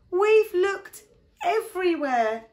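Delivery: high, loud, drawn-out despair; exasperated. Acted. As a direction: wail it slowly in frustration. Pip despairs of the search.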